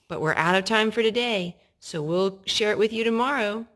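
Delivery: plain reading voice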